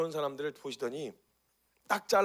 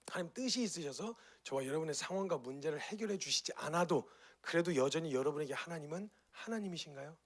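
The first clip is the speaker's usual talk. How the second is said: normal talking voice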